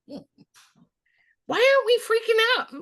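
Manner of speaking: very high, slow, disbelieving cry; mock bewildered. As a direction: stretch out the confusion, high up. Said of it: "I was like".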